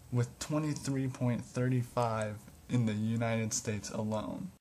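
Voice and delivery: loud, bright show-host read; upbeat presenter opening the show; presenter intro. Acted. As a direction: announce it like a video host.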